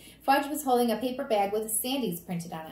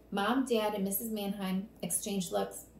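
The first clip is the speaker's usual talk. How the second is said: ordinary delivery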